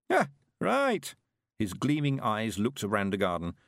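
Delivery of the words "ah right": put-on high, slow creaky old man voice; an eager old countryman, chuffed; old Mister Weevil.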